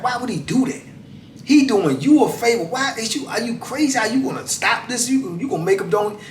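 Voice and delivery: high, exasperated inner voice scolding the absent twin; imagined scolding. Retold or imitated. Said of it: "then it sends you into thinking, like".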